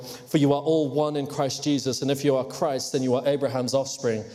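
usual speaking voice

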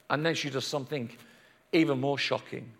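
low, soft confiding voice; quiet, ominous storyteller aside; hushed suspense. A narrator's reading. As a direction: drop right down and draw them in. Same words repeated hushed for suspense.

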